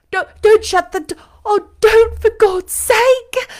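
high, loud, frantic begging, slowing on the last words; desperate plea. Acted. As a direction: cry out to stop the door closing. Begging at the shut door.

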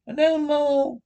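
Mole chiming in. A narrator's reading. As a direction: pipe up high and emphatic. Mole adds to the scolding.

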